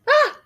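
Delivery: high loud gasp and cry; startled gasp. Acted. Larry turns yellow, high startled gasp. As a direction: gasp and yelp in shock.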